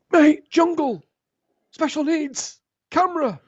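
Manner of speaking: very high, loud bursts of recognition; excited shout. Retold or imitated. Friend in the van yelling the dream's clues, very high and excited. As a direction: yell each word as it clicks.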